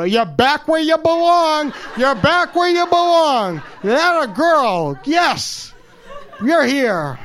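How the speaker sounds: high, slow and laughing with delight; joyful welcome. Retold or imitated. Man telling his barber she belongs back, high and delighted. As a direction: stretch each word out in happy relief.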